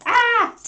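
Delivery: sudden high, loud yelp; startled yelp. Real, not acted. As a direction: cry out as teeth land. Puppy bites her.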